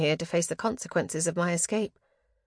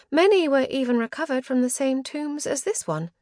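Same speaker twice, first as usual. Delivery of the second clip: higher, lilting charm to flatter the host; 7 semitones higher, 3 dB louder than usual. A narrator's reading. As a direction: put on your most charming voice. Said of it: "donned my most charming voice".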